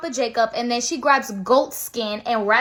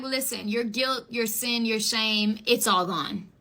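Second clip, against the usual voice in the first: softer, slow absolution; gentle reassurance; 3 semitones lower, 6 dB quieter, slower than usual. Acted. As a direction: tell him tenderly he's forgiven. Angel absolving Isaiah.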